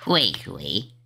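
low, smug chuckle; mocking villain chuckle. Villain boasts to the explorers with a low smug chuckle. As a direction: chuckle like a bully who knows his boss is coming.

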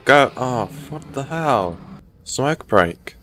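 usual speaking voice

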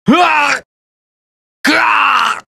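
high yell of pain; pained cry. Acted. Fighter taking a heavy hit, pained yell. Recorded damage reaction sounds.

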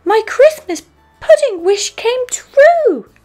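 put-on very high, loud, thrilled child; Peppa. A narrator's reading. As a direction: cry out with squealing joy. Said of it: "Peppa cries".

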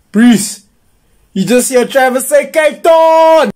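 loud, high, slow hyped yell; shouting in disbelief to the crew; amazed shout. Acted. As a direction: bellow it to the whole street, stunned.

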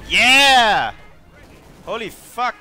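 triumphant yell. Real, not acted. Just killed two squads.

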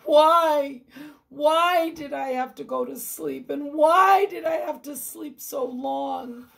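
sick man who missed his chance at healing; anguished lament. Acted. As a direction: berate yourself in grief. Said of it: "Hiram cried to himself".

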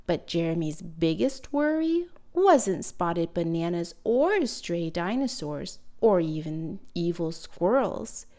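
ordinary delivery

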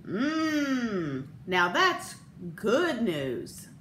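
slow, satisfied ad declaration; mock satisfied husband; declares. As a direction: savor it like a commercial husband.

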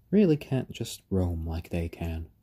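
ordinary talk

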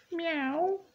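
very high insistent meow; cat's cry. A narrator's reading. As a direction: mimic a cat begging for attention. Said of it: "cried Pilchard loudly".

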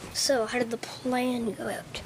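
normal talking voice

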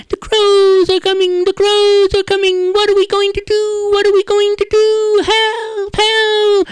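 very high, loud squeaky cries for help; tiny mice shrieking in panic; panicked field mice. Acted.

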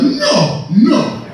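put-on high, indignant African accent; indignant African man. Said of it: "brothers that are actually from Africa".

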